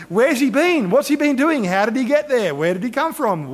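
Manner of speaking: high, loud, puzzled questioning; flurry of questions. Acted. Preacher voices a flurry of questions.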